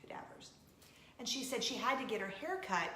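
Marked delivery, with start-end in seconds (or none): 0.0-0.5 a grossed-out whisper of the word Cadavers; whispered aside, squeamish. Shown as a demonstration. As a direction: breathe Cadavers out with no voice. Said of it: "working with cadavers".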